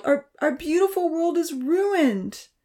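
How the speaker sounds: high, slow tearful lament; slow crying wail, voice catching. A narrator's reading. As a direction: grieve over the ruined world. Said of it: "Amy began to cry".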